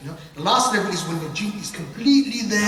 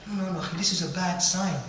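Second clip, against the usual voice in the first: ordinary talk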